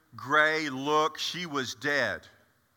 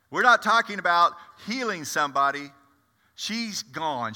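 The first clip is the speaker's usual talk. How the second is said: ordinary delivery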